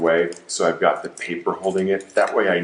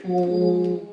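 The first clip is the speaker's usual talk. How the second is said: puckered lips, rounded ooh; lips pursed; 11 semitones higher than usual. Shown as a demonstration. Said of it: "I want you to pucker your lips and say".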